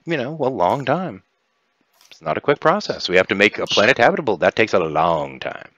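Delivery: high, swooping, persuasive negotiator; scheming Kiva. Acted. Kiva offers players a new planet, coaxing. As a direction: dangle an offer after spelling out the danger.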